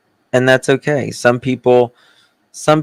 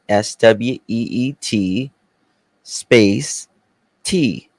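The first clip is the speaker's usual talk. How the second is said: normal talking voice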